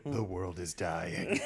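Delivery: slow, soft voice of doom; gloomy inner thought. Acted. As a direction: drag it out as a secret dread. Same character's gloomy inner thought.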